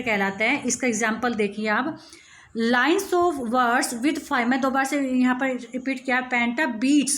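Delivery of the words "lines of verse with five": slow dictation. Shown as a demonstration.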